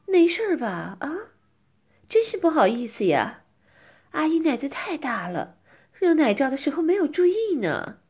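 loud coy apology; mock-sorry and simpering; coy, dainty. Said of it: "娇气地说".

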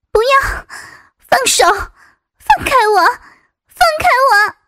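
rising panicked cries, near tears; desperate pleading. Acted. Captive woman struggling against attacker. (惊恐挣扎的哭喊)

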